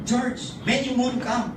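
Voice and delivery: high teasing pidgin question; lightly mocking skeptics; imitating doubters. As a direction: tease him like old friends. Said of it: "they will tell me".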